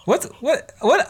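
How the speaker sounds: high-pitched stunned what what what; can't believe she got slapped; shocked disbelief. Retold or imitated. Nino reacts to the slap with high shocked questions. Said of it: "So she's like".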